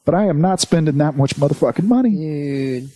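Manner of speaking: high mock inner voice; inner voice quote. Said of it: "it's like".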